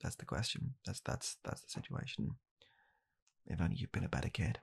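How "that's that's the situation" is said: ordinary talk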